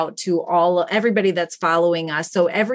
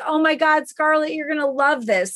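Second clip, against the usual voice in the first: higher, delighted excitement; excited; 7 semitones higher than usual. Retold or imitated. Tim telling Scarlett the news from China, excited. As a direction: imitate Tim, thrilled to share the news. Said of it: "And Tim's like".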